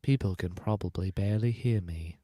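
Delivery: hushed, whispery audiobook narration; barely audible, like a whispering audiobook reader. Acted. Host doubting listeners can hear him, hushed audiobook voice. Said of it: "You sound like an audiobook".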